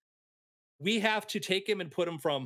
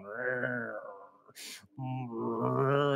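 low rumbling growl from the throat; imitated cat growl; 3 semitones lower than usual. Storyteller growling as the angry cat. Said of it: "the cat's going".

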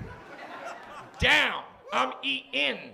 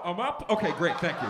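normal talking voice

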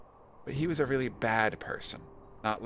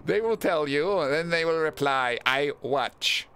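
loud, raised proclamation easing down in pitch; solemn watcher character; 5 semitones higher, 6 dB louder, slower than usual. Acted. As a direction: declare it like an all-seeing watcher. Voices watcher in the game.

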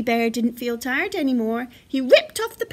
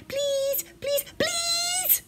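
put-on very high, squeezed child's pleading; begging; much slower than usual. A narrator's reading. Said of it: "begged Baby Bear".

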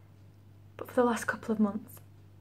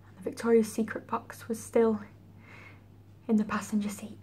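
tragic heroine sorrow. Acted. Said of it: "That's a feeling".

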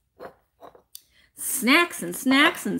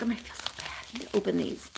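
whispered, much softer; guilty whisper; 2 semitones lower, 11 dB quieter, faster than usual. Retold or imitated. Seller retelling her guilt over the unopened boxes, whispered. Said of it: "I'm like".

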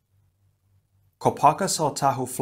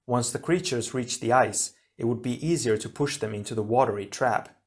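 ordinary delivery, nothing marked

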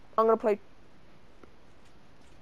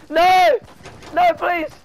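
loud, high pleading shouts; desperate shout; 9 semitones higher, 10 dB louder, much slower than usual. Real, not acted. Gamer begging not to get killed, loud high pleading. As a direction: beg loudly not to die. Begging while being shot.

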